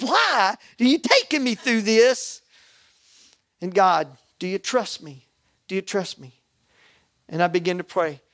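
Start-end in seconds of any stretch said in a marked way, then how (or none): 0.0-2.4 slow, higher-pitched questioning of God; pleading, drawn-out protest; anguished plea. Retold or imitated. 4.4-6.4 soft, hushed question; softly voiced, retold divine reply; gentle voice of God. God's gentle answer to his plea.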